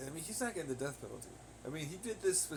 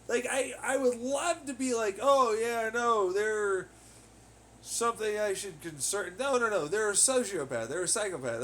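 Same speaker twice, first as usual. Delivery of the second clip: much louder and higher; heated yelling; 7 semitones higher, 7 dB louder than usual. Real, not acted. Ranting about a sociopath, loud exasperated yelling. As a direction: yell it out in exasperation.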